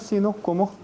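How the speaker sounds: ordinary talk, nothing marked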